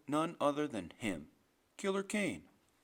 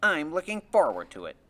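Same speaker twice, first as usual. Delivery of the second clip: loud, emphatic boast; bold bravado; 10 dB louder than usual. Acted. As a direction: declare it boldly, full voice. Freak boasts about the operation.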